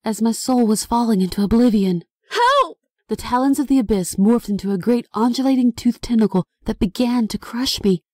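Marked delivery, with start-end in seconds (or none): 2.3-2.8 very high desperate yell; screaming for help. Acted. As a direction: cry out in terror. Dragged into the void, screaming.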